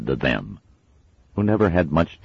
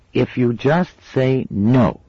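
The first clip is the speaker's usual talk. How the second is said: slow, higher bargaining voice; coaxing girl; 11 semitones higher, 5 dB louder, much slower than usual. A narrator's reading. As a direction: bribe the little sister. Pepper bargaining.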